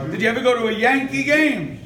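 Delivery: ordinary talk, nothing marked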